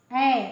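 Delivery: ऐ voiced loud with the mouth wide open; demo sound ऐ. Shown as a demonstration. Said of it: "बीच में आवाज़ निकली है".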